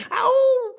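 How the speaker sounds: high, loud "ow"; cry of pain. A narrator's reading. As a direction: yelp as if trodden on. Trodden-on mouse cries out.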